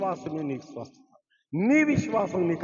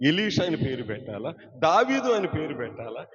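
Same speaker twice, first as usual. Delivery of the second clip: usual speaking voice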